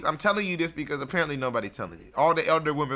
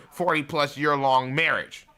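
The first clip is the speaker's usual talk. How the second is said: normal talking voice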